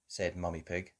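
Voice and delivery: plain reading voice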